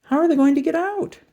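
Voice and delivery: put-on high, wondering child's question; boy wondering how they'll get out, high; little boy voice. A narrator's reading. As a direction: ask it like a puzzled small boy. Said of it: "the little boy said".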